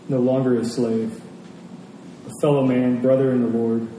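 normal talking voice

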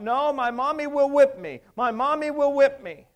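put-on high crying child's voice. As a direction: wail it out like a scared little girl. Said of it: "began to shout out".

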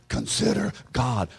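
normal talking voice

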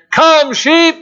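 high, drawn-out farm holler; earnest copy of a shepherd's loud call; mimicked pastor's call. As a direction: imitate the pastor yelling for his sheep. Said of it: "tried to mimic the pastor's voice".

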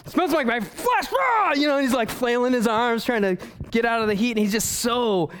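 high, strained cries of a man baking in the sun; angry Jonah in the heat. Acted. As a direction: flail and yell at the scorching heat.

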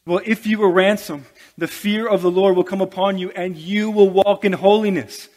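loud, ringing pulpit proclamation; preaching at full force. Real, not acted. Pastor urging the church toward holiness, loud preaching. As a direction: declare it to the whole room.

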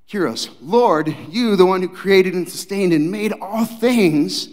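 incredulous Peter. Acted. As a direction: let Peter's shock spill out slowly. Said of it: "Better translated is like".

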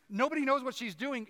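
ordinary talk, nothing marked

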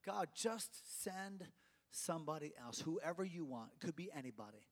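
softly voiced, low-key deflection; hushed, sinking plea; reluctant Moses. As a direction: quietly pass the job on. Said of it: "Moses was saying".

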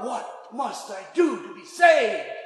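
slow, pleading shout; desperate cry. Acted. The jailer cries out to Paul, desperate to be saved. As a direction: cry out for rescue. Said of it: "And he cries out".

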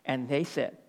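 usual speaking voice

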